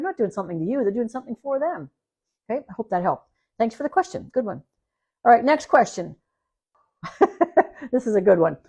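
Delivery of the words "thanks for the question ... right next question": ordinary talk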